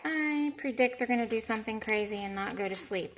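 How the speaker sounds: ordinary talk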